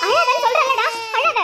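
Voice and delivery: put-on high, exasperated snap at a child; angry scolding. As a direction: order the crying child to stop, losing patience. Mother snaps at wailing baby.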